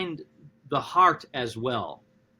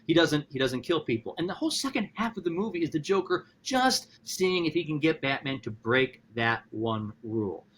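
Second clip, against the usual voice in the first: ordinary delivery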